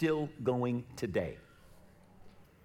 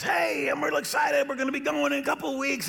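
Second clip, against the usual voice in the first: imitating an eager friend calling with big news; excited; 12 semitones higher than usual. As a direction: burst out with excitement over the trip. Retold excited phone call.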